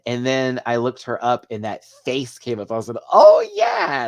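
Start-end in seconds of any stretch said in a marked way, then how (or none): 3.1-4.1 high, loud "oh yeah" of realisation; sudden recognition. Retold or imitated. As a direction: exclaim as it suddenly clicks. Acting out sudden recognition of actress.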